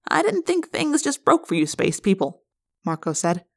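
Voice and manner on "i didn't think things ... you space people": high-pitched teasing quip; wisecracking boy. A narrator's reading. Marco teases his alien friend, wisecracking.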